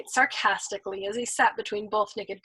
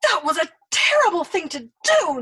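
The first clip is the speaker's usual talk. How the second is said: higher, louder reproach; reproachful shout; 8 semitones higher, 7 dB louder than usual. Acted. Nately scolding the old man, loud reproachful shout. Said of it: "Nately shouted at him reproachfully".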